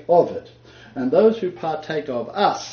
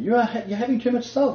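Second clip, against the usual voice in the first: quick, higher mock wife scolding; lightly voiced wife telling him off, quicker; 8 semitones higher, faster than usual. Wife scolding husband about salt, quick higher mock voice. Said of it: "the wife of one of them saying".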